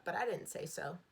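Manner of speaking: ordinary delivery, nothing marked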